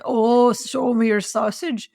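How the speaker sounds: slow, drawn-out mimicry of a co-host; mocking quote. Escort mocks co-host's sexy line, mock quote. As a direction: draw it out in mild mockery. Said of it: "Nobody says".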